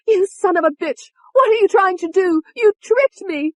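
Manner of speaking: very high, loud accusation; outraged high-pitched shriek; furious screaming. A narrator's reading. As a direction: scream at the betrayer. Betrayed alter screams at David.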